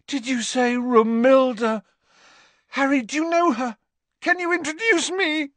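very high, eager, lovestruck gushing; hopeful. A narrator's reading. Dangling Ron begs Harry for introduction, hopeful. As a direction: plead eagerly, pitched right up. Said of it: "looked tremendously hopeful".

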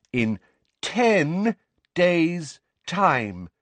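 very drawn out, high and loud; loud, slow voice. A narrator's reading. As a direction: give each word its own space. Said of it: "that loud, slow voice".

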